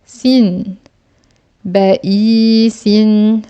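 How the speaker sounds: Ba i sin stretched out very slowly; slow syllable-by-syllable reading. Shown as a demonstration. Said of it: "La longue syllabe ici".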